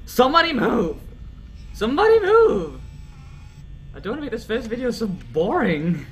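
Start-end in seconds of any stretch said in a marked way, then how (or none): 0.1-2.8 loud, high demand building higher; raised, rising-pitch shout; calling out. Real, not acted. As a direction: call out impatiently for some action. Goading the still animatronics.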